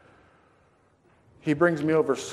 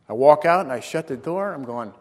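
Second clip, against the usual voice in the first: normal talking voice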